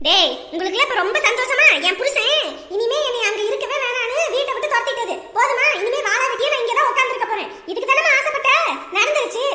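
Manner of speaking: bitter accusation. Acted. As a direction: throw it in their faces.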